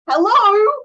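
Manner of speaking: a loud, high, drawn-out hello; exasperated hello. Acted. Coach mocking clients who ignore her, loud high hello. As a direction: sing out hello like nobody's listening.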